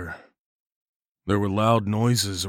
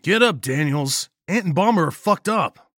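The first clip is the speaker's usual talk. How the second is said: urgent shout; 9 semitones higher, 6 dB louder than usual. Acted. Jacob urgently waking Daniels.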